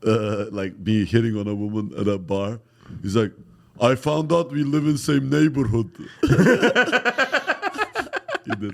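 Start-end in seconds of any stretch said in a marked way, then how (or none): 3.8-5.9 higher-pitched broken-English pickup line; impression in accent. Comic quoting his impersonator at a bar, in accent. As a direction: lightly exaggerate your own foreign accent. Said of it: "I'm doing my impression".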